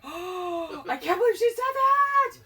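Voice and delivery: high, loud scandalized gasp; shocked audience. Retold or imitated. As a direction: clutch your pearls, aghast. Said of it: "The audience is looking at us, like".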